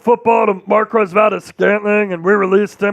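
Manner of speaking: lightly voiced quarterback, a bit gravelly; a hint of a gravelly star quarterback; Patrick Mahomes voice. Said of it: "that's kinda Patrick Mahomes".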